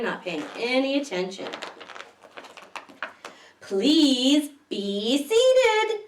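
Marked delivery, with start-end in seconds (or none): 3.6-6.0 very slow, higher, louder request; librarian voice. Acted. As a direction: stretch it out like a patient librarian. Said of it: "she says".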